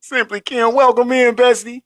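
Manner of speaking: high, bright-voiced shout-out; excited welcome. Real, not acted. Host welcomes a new chatter, high and excited. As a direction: greet her like a bestie.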